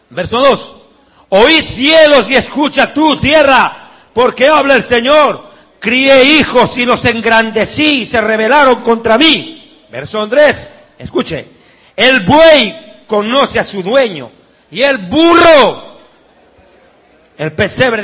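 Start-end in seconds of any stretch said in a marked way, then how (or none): 1.3-9.8 loud, slow proclamation of the verse; solemn scripture reading. Acted. Reads Isaiah solemnly. 11.9-18.0 loud scripture reading. Acted. Reads verse loudly.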